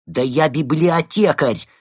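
loud, high, slow protest; indignant. A narrator's reading. Penguin defending himself to the fox, indignant. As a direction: protest with offended pride. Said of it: "возмутился Евгений".

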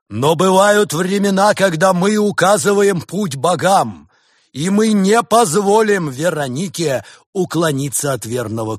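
loud, high, slow oratory; rally the flock with overblown pathos; hammy stage actor. A narrator's reading. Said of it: "воскликнул Барс".

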